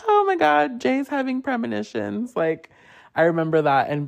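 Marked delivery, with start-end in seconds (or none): 0.1-2.4 high, mocking squeal of teasing friends.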